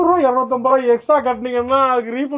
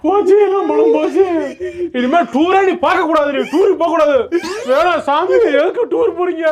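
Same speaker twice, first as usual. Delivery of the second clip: mock despair; 5 semitones higher than usual. Despair over lost money.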